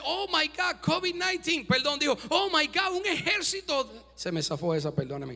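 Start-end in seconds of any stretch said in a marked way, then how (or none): 0.0-1.6 mock panic, higher pitched; a hammed-up fright at the sight; startled servant. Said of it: "se asusta y dice". 2.3-4.1 mock panic, higher pitched; a hammed-up fright at the sight; startled servant. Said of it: "se asusta y dice".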